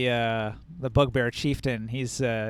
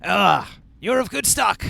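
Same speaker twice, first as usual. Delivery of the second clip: raised, louder gruff sergeant; bugbear sergeant voice; 4 semitones higher, 4 dB louder than usual. Acted. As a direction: size him up with approval. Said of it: "he's going to say".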